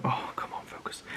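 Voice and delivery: hushed whisper to himself; whispered aside. Real, not acted. Builder whispering to himself to focus. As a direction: breathe it under your breath, off-mic. Muttering at himself mid-demo.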